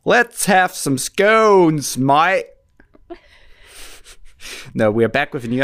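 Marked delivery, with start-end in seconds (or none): 0.0-2.5 high, loud, slow caricature British; mock British accent. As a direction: drawl it in exaggerated British. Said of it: "in a British accent".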